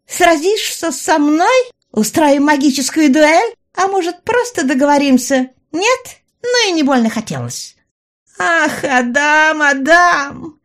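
high, loud villainess with a wide smile; Раяна, clamouring. A narrator's reading. Villainess goads the man busy holding back fire, clamouring. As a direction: taunt and coax in a high-pitched, theatrical voice. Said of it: "вновь заголосила Раяна, широко улыбаясь".

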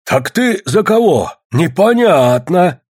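high, slow, drawn-out call; shouted demand. A narrator's reading. Lomov challenges Spirka before the crowd, shouted demand. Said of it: "крикнул Ломов".

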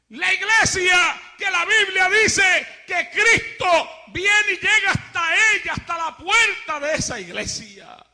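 loud fiery preaching, slow and hammered; preaching at full force. Acted. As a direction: thunder it from the pulpit. Preacher thunders at worldly church.

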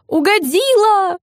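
very high, drawn-out squeal; delighted squeal. A narrator's reading. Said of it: "Марина взвизгнула". (радостно взвизгнуть, высоко и протяжно)

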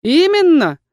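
confirming. A narrator's reading. Erkhan confirming to the heroine, emphatic. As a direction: say it like a firm yes. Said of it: "подтвердил Эрхан".

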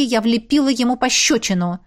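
ordinary delivery, nothing marked

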